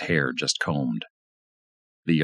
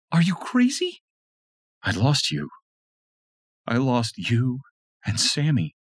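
higher woman's voice, upset; wife distraught over lost husband; distraught Tanya; 10 semitones higher than usual. A narrator's reading. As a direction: cry out in relief and fear.

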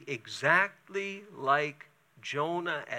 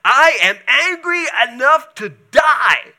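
shouted high and loud, furious Jonah; hammed-up furious prophet shout; angry shout; 8 semitones higher, 12 dB louder than usual. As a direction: yell it like a sulking prophet at the end of his rope.

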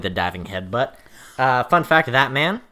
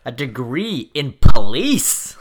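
scoffing; 9 dB louder, much slower than usual. Real, not acted. Scoffing retort question.

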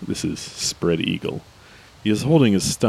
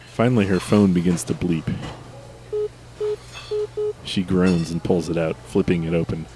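ordinary delivery

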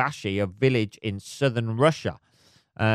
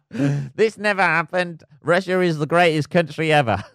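mock Russian official; 5 semitones higher, 5 dB louder than usual. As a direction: ham up the state denial.

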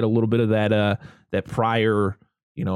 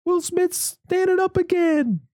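much higher, stunned crowd exclamation; amazed crowd; slower than usual. Retold or imitated. Wedding guests shocked as Mike stands up, stunned and high. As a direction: gasp out the crowd's disbelief, high. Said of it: "everybody's like".

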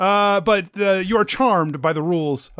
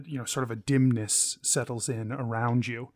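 ordinary talk, nothing marked

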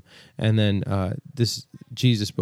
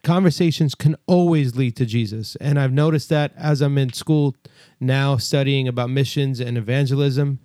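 preaching; 4 semitones higher than usual. Acted. Exhorting listeners to evangelize.

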